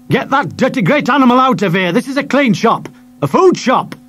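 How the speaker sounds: high, loud shout at a dog in his shop; furious chip-shop owner; angry shopkeeper yell. Acted. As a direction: bellow him out of the shop. Nasty shopkeeper yells at dog.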